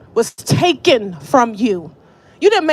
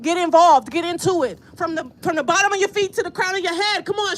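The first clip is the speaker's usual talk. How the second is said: ordinary talk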